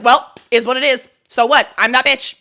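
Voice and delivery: loud, punchy defiance; acted brash attitude; aggressive and blunt. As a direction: throw it out loud and unapologetic. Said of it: "very aggressive and, like, blunt".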